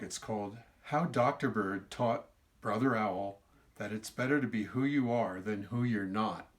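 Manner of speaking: usual speaking voice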